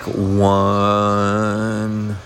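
long, stretched umpire strike call. Pretend. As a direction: drag it out like an umpire.

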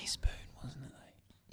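very soft whispered aside; hushed, voiceless query; whispered hint. Real, not acted. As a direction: whisper the doubt under your breath. Friend quietly hinting at the answer.

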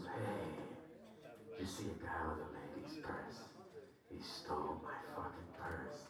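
low, soft, spaced-out meth lady voice. Retold or imitated. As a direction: drop it down and murmur like a dazed stranger. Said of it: "Same, verbatim".

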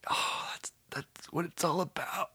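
whispery, very soft, overcome voice; mock choked up. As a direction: pretend tears are stealing your voice. Mock-moved by a tearjerker movie.

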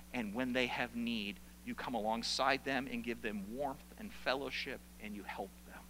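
soft, low pastoral voice; hushed preaching. Real, not acted. Preacher gently explains serving the needy, hushed preaching. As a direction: speak softly and low, letting it sink in. Preacher applies verse tenderly.

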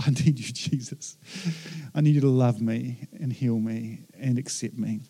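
low, hushed plea to Jesus; soft prayer. Acted. Preacher voicing a needy prayer to Jesus, softly.

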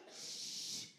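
long hushing "shh"; imitated shushing. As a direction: hush someone making noise. Imitates someone hushing a hollerer.

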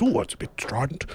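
high, soft crooner voice. Retold or imitated. Imitating [name] listening to the band.

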